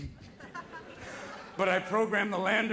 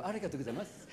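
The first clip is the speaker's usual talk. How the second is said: very soft, low thanks; hushed, courteous Japanese; polite Japanese speaker; 4 semitones lower, 10 dB quieter, much faster than usual. Acted. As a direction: imitate a demure Japanese businessman. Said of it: "you can be very polite during the day".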